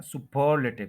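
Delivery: Superlative as su-PER-luh-tiv; superlative with heavy second syllable, quick weak luh; stress on second syllable. Shown as a demonstration. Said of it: "second syllable पे आपने stress".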